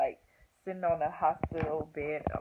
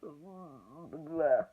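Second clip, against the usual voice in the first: long strained "mm" groan; imitated pained groan; 2 semitones lower than usual. Showing how she sounded in labor, imitated groan. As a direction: re-enact groaning through a contraction. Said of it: "like that".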